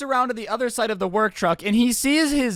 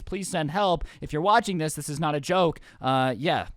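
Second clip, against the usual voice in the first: ordinary talk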